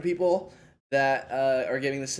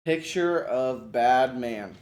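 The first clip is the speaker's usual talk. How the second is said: "Picture of bad man" said very slowly, each word spaced out; slow modeling voice; 4 dB louder, much slower than usual. Shown as a demonstration. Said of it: "this would kinda be a modeling thing".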